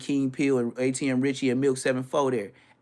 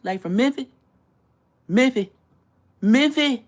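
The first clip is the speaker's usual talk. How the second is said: mock local "Mimfih", higher and louder each time; imitating Memphis locals; 11 semitones higher, 7 dB louder than usual. Mimicking how Memphis people ask where he's from. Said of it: "That's how they say it".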